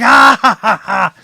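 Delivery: loud high jeering cackle; mocking laugh. Voice behind the door laughing at the demon, loud and high. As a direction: laugh at him as you go.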